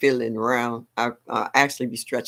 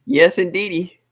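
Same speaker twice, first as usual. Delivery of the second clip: louder, punchy yes indeedy; loud catchphrase; 5 semitones higher, 7 dB louder than usual. Real, not acted. Host cheering her catchphrase to viewers, loud.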